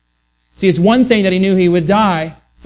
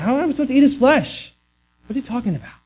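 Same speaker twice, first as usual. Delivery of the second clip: higher-pitched, baffled murmurs from the crowd; murmuring crowd; 7 semitones higher, 3 dB quieter, much faster than usual. Retold or imitated. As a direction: imitate puzzled listeners grumbling among themselves. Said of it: "You can kind of hear the murmurs".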